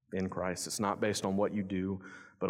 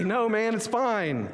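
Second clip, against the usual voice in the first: breezy refusal; 10 semitones higher, 4 dB louder, slower than usual. Retold or imitated. Turning down boss's job offer.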